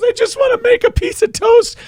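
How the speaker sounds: very high squeaky woman. Retold or imitated. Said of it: "goes to the cricket and goes".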